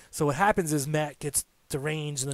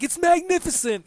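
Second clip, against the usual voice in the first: put-on much higher, grandly savored, in Broken Matt's accent; 5 dB louder than usual. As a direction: marvel like a pompous madman in Broken Matt's accent. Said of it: "And he's like".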